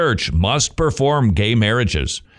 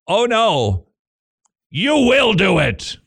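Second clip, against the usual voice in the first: stern insistence; 8 semitones higher, slower than usual. Retold or imitated. Church voiced, insisting.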